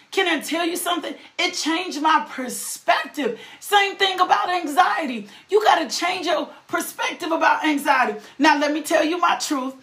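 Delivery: usual speaking voice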